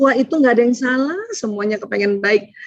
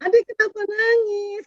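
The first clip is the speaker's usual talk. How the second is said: high, questioning mother; pressing the child for a reason; mother asking her crying child; 10 semitones higher, 4 dB quieter than usual. Retold or imitated. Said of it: "Kan kata ibunya ya". (ibu mendesak anak dengan nada tinggi)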